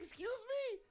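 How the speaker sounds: high-pitched incredulous exclamation; mock offended. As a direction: squeak it out, hammed-up affront. Acted offended reaction in story.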